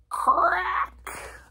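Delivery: high, sharp imitated cracking noise; loud crack. A narrator's reading. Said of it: "he heard a loud".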